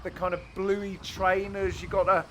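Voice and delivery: ordinary delivery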